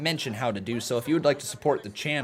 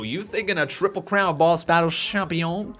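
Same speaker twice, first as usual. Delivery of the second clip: ordinary delivery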